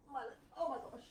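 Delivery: ordinary talk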